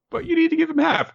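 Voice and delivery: very high, quick crab voice; hermit crab. Acted. Crabs argue with their leader, high and quick. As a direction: squeak it quickly as a little crab. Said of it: "his hermit crabs say".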